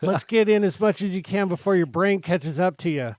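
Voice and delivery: laughing through a greedy inner voice; laughing quote. Pretend. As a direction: giggle out the stomach's plan. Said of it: "It's like".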